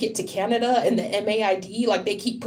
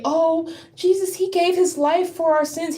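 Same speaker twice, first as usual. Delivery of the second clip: mocking Christians; 7 semitones higher than usual. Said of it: "And they're gonna say".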